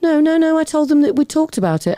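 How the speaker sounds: high, insistent mock denial; defensive mother. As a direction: imitate a mother protesting, pitched right up.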